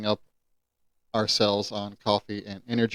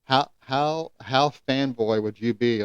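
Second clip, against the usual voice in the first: ordinary talk, nothing marked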